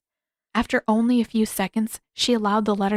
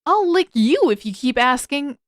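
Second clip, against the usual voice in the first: annoyed threat; 6 semitones higher, 6 dB louder than usual. A narrator's reading. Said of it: "Sokka shot him an annoyed look".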